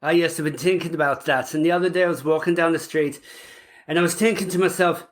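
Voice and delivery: quick, chatty Irish accent. Shown as a demonstration. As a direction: put on an Irish storyteller's lilt. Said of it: "I probably could do an Irish accent".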